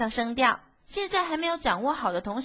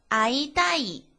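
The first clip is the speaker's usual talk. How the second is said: 正常速度. Shown as a demonstration. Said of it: "快速的读一遍的话".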